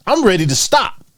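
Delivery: ordinary talk, nothing marked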